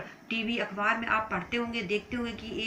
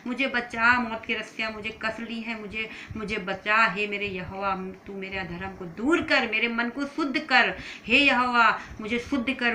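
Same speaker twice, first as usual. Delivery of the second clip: raised, urgent imitated plea; crying out to God; 2 semitones higher, 7 dB louder than usual. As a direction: call out like a child begging God for rescue. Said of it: "चिल्लाता था".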